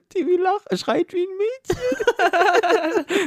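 hohe, vor Lachen kippende Stimme; sehr hoch, den Schluss langgezogen ins Lachen ziehen; nachgespielter Lachflash. Retold or imitated. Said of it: "dann krieg ich einen Lachflash". (im Lachflash gesprochen)

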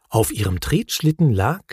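usual speaking voice

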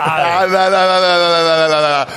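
loud sing-song na na, wagging a finger; teasing na na na. Real, not acted. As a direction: tease the dodge with a loud chant. Teasing refusal of evasive answer. (lautes neckendes Na-na-na)

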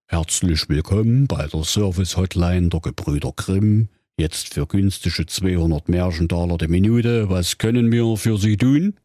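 Grimm brother as a call-centre recording; a polite hotline greeting from Jakob Grimm. Acted. (Jakob Grimm als Hotline-Ansage)

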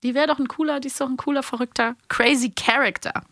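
normal talking voice

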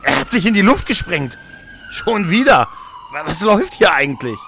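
high and loud, stunned disbelief; shocked outburst. Acted. Hearing of another suicide bombing, shocked and loud. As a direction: blurt it out, shaken by the news.

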